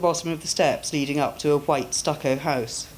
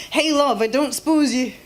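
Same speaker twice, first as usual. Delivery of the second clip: cheery Scottish rough sleeper, higher and louder, calling out; Scottish street beggar; 9 semitones higher, 4 dB louder than usual. Acted. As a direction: hail a passer-by like a friendly Glaswegian beggar.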